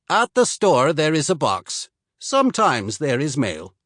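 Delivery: lightly voiced Swede pointing the way; slight Swedish accent. Swede tells the soldier where mail is kept, slight Swedish accent. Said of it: "He spoke with only a slight accent".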